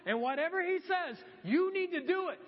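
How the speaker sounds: put-on a mother vouching for her boy, pushing them to obey; mock-commanding mom to the servants; proud bossy mom. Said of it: "I can just imagine her going".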